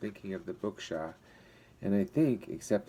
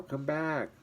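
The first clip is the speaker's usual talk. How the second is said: higher calling after Brandon; shouted; 7 semitones higher than usual. A narrator's reading. Val calling after fleeing Brandon, a higher-pitched shout. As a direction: call her back across the mist. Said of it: "shouted Val".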